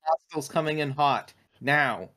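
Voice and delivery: ordinary delivery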